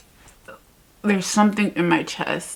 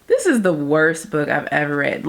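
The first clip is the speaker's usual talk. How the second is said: ordinary talk, nothing marked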